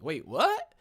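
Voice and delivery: loud, high double take; shocked. Retold or imitated. Patient hit with a startling question from the specialist, shocked. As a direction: blurt it out in disbelief.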